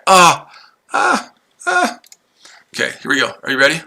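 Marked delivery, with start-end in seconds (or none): 0.1-2.0 squeaky fake sob, hammed-up; mock high whimper. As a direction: pretend to whimper like a baby. Mock crying after "about to cry.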